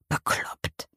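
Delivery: whispered "Bekloppt" in disbelief; whispered "Bekloppt", scoffing softly; disbelieving whisper. A narrator's reading. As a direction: breathe "Bekloppt" under the breath, unbelieving. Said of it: "Sie klingt ungläubig".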